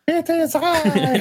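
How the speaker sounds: very high, slow, laughing heroic voice; hammed-up grand hero, breaking into laughs; mock heroic hero.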